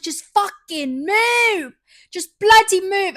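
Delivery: loud, high furious yelling at traffic; angry shout. Retold or imitated. Stuck in traffic, yelling at other drivers, furious shout. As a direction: scream it at the cars ahead. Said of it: "I was stuck in this traffic and I was like".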